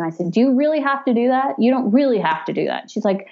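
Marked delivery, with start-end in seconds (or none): 0.3-1.6 higher, fast pleading question. Retold or imitated. A patient in labor asks the nurse to skip the IV, pleading. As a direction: beg the nurse not to put in an IV.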